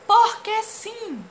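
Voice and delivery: high, slow, loud cartoon question. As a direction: stretch it like a cartoon kid. Reading comic character's line. (voz de desenho, aguda e lenta)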